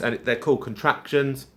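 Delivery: ordinary talk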